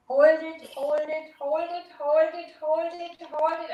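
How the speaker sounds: straining while holding breath. Shown as a demonstration. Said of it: "you hold your breath".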